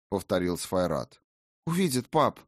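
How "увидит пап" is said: put-on young girl's voice. Daughter protests.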